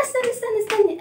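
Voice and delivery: high-pitched, hurried plea to wait; urgent interruption. Acted. Letter stops the kasra in a children's story, urgent interruption. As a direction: cut in with quick high repeats.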